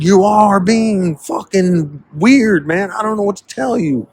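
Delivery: high, slow, drawn-out mocking voice; mock whine, very high. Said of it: "It's like".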